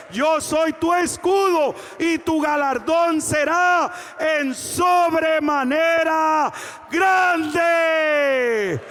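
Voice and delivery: impassioned preaching building to loud, drawn-out shouts; full-force preaching. Real, not acted. Preacher assuring the congregation God is their shield, full-force preaching. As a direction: proclaim God's promise at full voice, stretching the climax.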